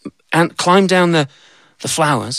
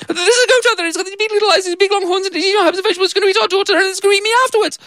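very high frantic voice; breathless rush of panic; panicked wife; faster than usual. Acted. Wife running to her husband about the goat, high and frantic.

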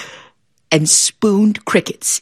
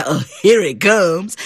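put-on boy frog voice; slower than usual. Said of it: "And the boy frog said".

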